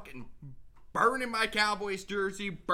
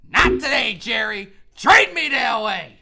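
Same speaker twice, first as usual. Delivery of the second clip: mock defiant shout; 12 dB louder than usual. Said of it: "I'll give you a G six".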